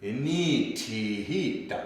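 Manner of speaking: INI TI HI drawn out slowly, DA short; with prosody. Shown as a demonstration. Teacher asking students to listen, syllables with full prosody. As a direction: stretch each syllable of Ini ti hi, quick final da. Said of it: "Now listen to it".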